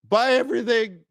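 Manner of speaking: high parody voice; mocking naive trader. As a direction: send up the clueless diversifier. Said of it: "You're like".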